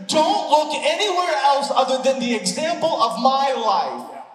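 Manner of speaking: rising passionate pulpit delivery; full-force preaching. Acted. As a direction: preach it out to the whole room.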